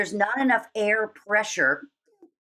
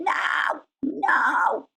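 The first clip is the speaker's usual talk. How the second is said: creaky fry scream; creaky gritty yell; 5 semitones higher than usual. Shown as a demonstration. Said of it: "You went".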